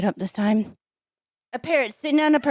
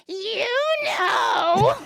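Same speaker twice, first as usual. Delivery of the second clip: high, drawn-out parrot squawk; talking parrot; 12 semitones higher than usual. Shown as a demonstration. Comic showing how she practiced in the shower, parrot squawk. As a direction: screech it long and high like a parrot. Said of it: "in the shower, I'd be like".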